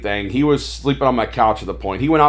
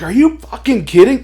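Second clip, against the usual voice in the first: incredulous, high-pitched and louder; acted disbelief; 12 semitones higher, 6 dB louder than usual. Flea market seller amazed at the toys dumped cheap, incredulous. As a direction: blurt it out in mock astonishment.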